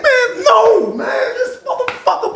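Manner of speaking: very high, cracking with laughter; laughing in disbelief. Real, not acted. Laughing as he retells the neighbor's wail. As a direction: laugh through it, can't believe it. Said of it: "I'm like".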